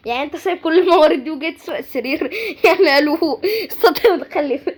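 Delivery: laughing through the words; incredulous, breaking into laughs; amused disbelief. Real, not acted. Laughing over his stolen bed.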